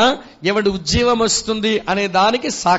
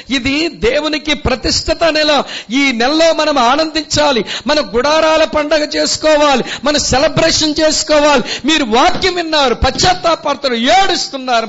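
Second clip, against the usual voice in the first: raised preaching voice, urging joy; fervent preaching; 5 semitones higher, 3 dB louder than usual. Acted. Pastor urges the congregation to rejoice, fervent preaching. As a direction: preach it out to the hall.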